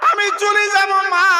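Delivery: preacher voices the son leaving his mother, wailing; anguished farewell cry. Acted. Son tearfully bids mother farewell.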